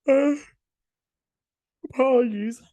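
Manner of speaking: said through a yawn. Real, not acted. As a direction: stretch the word out mid-yawn. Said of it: "I only yawn when I record".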